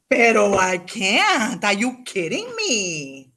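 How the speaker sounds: slow, drawn-out, stunned; overwhelmed disbelief. Real, not acted. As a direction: let the shock sink in slowly. Reliving her astonishment.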